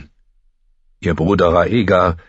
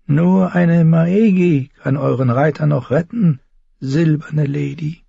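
raised-pitch sorceress, sly; taunting witch-healer Miri; 11 semitones higher, 4 dB louder than usual. A narrator's reading. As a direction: state your power to the lady knowingly.

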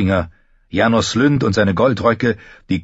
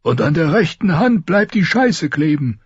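high-pitched king delivering his punchline; jesting king, voice raised high; Robert's voice; 9 semitones higher than usual. A narrator's reading. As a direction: pitch it well up for the joking king. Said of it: "hatte Robert gesagt".

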